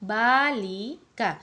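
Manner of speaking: બાલિકા drawn out, marking ઈ and કા; slow teaching demo. Shown as a demonstration. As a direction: stretch બાલિકા. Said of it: "ઈ આવ્યો ઉચ્ચાર સાથે અને કા".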